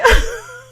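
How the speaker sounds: short high burst of laughter. Real, not acted. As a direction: giggle at yourself. Laughs again.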